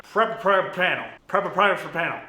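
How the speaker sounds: tripping over a tongue twister at speed; fast tongue twister. Shown as a demonstration. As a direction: rattle it off and muddle the words. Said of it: "Say that five times fast".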